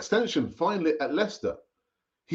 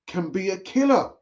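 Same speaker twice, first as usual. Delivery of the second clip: ordinary delivery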